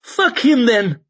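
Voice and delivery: loud, high furious outburst; angry snap. Acted. Sufin snapping at the old priest, loud and furious. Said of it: "snapped Sufin".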